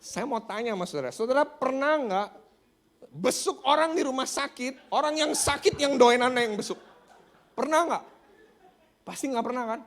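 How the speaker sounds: high, loud challenge to the congregation; impassioned pulpit shouting; full-force preaching. Real, not acted. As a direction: fire rhetorical questions at the crowd.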